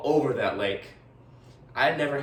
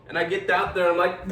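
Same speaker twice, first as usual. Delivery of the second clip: usual speaking voice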